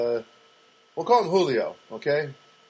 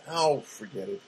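ordinary talk

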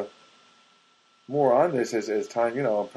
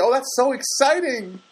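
high, drawn-out gushing; imitating an overexcited well-wisher; mock excited reaction; 14 semitones higher, 4 dB louder than usual. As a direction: gush slowly in a raised pitch. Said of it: "Some people say".

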